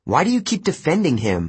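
high, exasperated question; snapping. A narrator's reading. Percy confronts Annabeth about defending Luke, snapping. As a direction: challenge it with irritation. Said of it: "I snapped".

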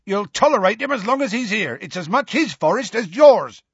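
high, indignant yell. A narrator's reading. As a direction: yell back defiantly. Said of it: "he yelled".

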